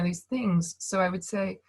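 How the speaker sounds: usual speaking voice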